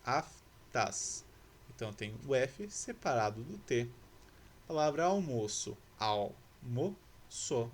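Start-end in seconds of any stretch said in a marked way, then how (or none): 0.1-1.2 "Aftas" broken as af, tas; split into syllables. Shown as a demonstration. Teacher showing how F and T separate, "Aftas" syllable by syllable. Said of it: "Separei aqui em sílabas". 6.0-7.7 "Almoço" very slowly as al, mo, ço; long pauses between the syllables of "Almoço"; split into syllables. Shown as a demonstration. Teacher showing L separated from M, "Almoço" syllable by syllable. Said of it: "L separado do M".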